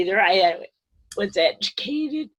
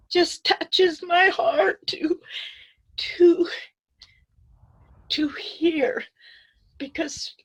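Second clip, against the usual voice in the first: high, tearful, words breaking off into a whisper; crying; 10 semitones higher, slower than usual. Real, not acted. Telling the elders their stories touch her heart, crying. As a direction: voice cracking as tears come. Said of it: "I'm sorry I'm crying".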